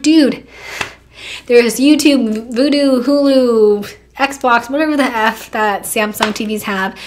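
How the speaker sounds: loud, drawn-out list in exasperation; exasperated. Acted. Listing TV apps to her clueless boyfriend, exasperated.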